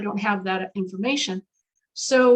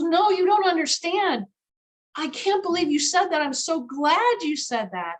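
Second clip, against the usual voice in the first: excited insistence; 6 semitones higher than usual. Retold or imitated. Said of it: "She goes".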